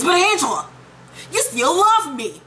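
hammed-up begging lover; mock lovesick plea. Mocking lovesick character's plea.

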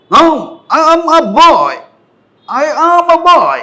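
very high, loud, stretched-out protest; rude over-emphasis. Acted. Learner answering "boy or girl" rudely, loud over-emphasis. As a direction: hammer every word, drawn out and insolent. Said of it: "বেয়াদবি পর্যায়ে করে ফেলছেন".

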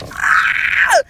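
high, loud burst of a scream; excited scream. Real, not acted. Collector overcome by her new toys, excited scream. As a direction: let out a thrilled shriek. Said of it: "I'm so excited".

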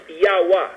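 "Yahweh" said as a way to pronounce the letters of Iowa; "Yahweh" in two syllables, YAH-weh; pronunciation demo. Shown as a demonstration. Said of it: "can be pronounced".

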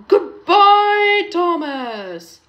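loud, high singsong farewell; long sailing call, falling away on the name. A narrator's reading. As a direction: crow goodbye while racing past. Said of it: "called Bertie".

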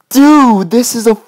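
high, loud "Dude"; bursting with real excitement; excited. Real, not acted. Horse back, overjoyed.

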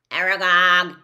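loud spider call across the hollow. Acted. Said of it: "it called".

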